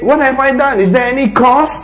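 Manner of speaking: high, bewildered protest; innocent David. Acted. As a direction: ask it in honest surprise. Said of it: "David says".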